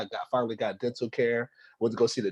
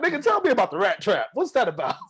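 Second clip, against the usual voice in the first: mock confront; 9 semitones higher than usual. Confronting landlord about trap.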